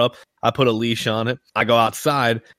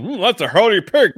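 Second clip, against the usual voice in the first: higher silly voice breaking into laughter; put-on appraisal; 7 semitones higher, 3 dB louder than usual. Joking about picking up pigs, silly put-on voice. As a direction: pretend to heft and admire, voice pitched up. Mock appraisal of a lifted pig.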